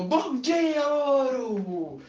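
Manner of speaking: high loud cheery shout; shouted greeting. Acted. Woman shouts good morning to the parrot. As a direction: call out eagerly to the parrot. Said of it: "ela abriu a porta e gritou".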